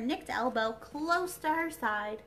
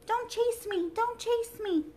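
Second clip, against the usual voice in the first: high, loud frightened plea; frightened little voice; 12 semitones higher, 3 dB louder than usual. Acted. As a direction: cry out, small and scared. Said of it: "She had such a frightened little voice".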